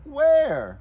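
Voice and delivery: high, loud, falling call; kids' show questioning. Shown as a demonstration. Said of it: "And then you can just go".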